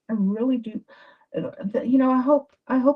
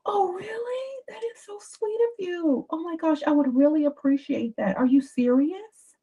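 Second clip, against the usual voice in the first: gushing delight; 10 semitones higher than usual. Shown as a demonstration. Coach showing women how to answer a man's offer, gushing delight. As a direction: sound overwhelmed and grateful. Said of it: "Oh, you say".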